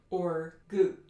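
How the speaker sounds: gut with a loose, lax u. Shown as a demonstration. Teacher demos the not-tense-enough mistake. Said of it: "That's not tense enough".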